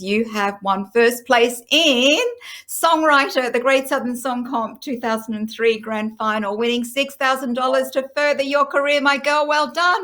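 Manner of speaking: excited winner announcement. Real, not acted. Host telling a songwriter by phone she has won, excited announcement.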